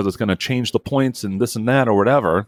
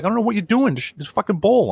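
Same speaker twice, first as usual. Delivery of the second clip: retold angry outburst; 10 semitones higher than usual. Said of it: "I just went off on him".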